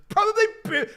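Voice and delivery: high, loud mock British accent, cut off; imitated British accent. Quoting a favorite video, imitated British accent. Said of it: "One of my favorite videos".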